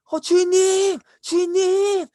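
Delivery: high, loud drawn-out hail; calling out shouting. Acted. Servants run out to their master, high excited shouts. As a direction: servant yelling from afar. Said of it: "이렇게 하고 소리치면서".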